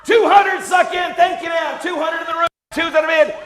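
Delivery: loud high excited calling; excited shout. Real, not acted. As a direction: shout it out, thrilled with the bid. Auctioneer shouting new bid.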